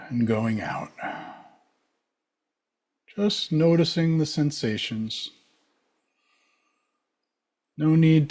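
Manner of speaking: calm, unhurried meditation guide with long pauses; spacious, settled instruction; guided meditation. Real, not acted. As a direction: lead them gently into the breath, leaving space between phrases.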